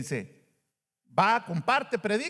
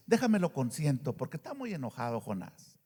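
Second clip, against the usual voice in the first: God's tender indulgence; 6 semitones lower, 6 dB quieter than usual. Retold or imitated. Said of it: "y Dios dice".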